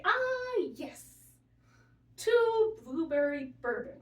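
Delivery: put-on high, loud, slow elderly-man voice; aphasia patient. Nurse retells patient ordering breakfast, high slow mock old man. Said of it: "And the nurse working with me is like".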